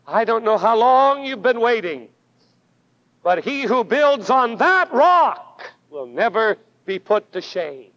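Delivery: usual speaking voice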